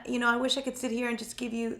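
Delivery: ordinary talk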